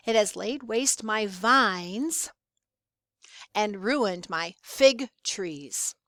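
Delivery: "vines" and "fig trees" drawn out, each set off by a pause; slow right down to land on "vines" and "fig trees"; stressed key words. Shown as a demonstration. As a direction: read slowly, leaning on "vines" and "fig trees" as clues. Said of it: "by my voice and the pauses".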